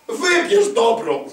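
high, loud, slow plea to choose good; urging call. Acted. Preacher voicing God, calling the faithful to choose good.

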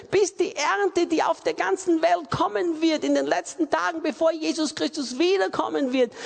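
high, forceful prayer of commitment; impassioned preaching. Real, not acted.

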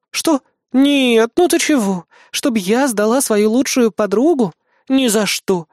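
high, exaggerated denial; emphatic assuring. A narrator's reading. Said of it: "заверила богиня". (горячо заверяя, высоким голосом)

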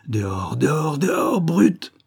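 repeated order rising to a high outcry; stammered fury. A narrator's reading. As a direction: sputter it out, climbing in pitch. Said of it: "Il balbutiait".

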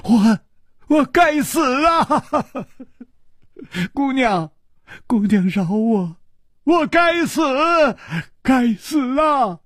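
high, shouted hoarse begging; hoarse wailing. A narrator's reading. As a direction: wail it out, hoarse and desperate. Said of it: "他嘶声说".

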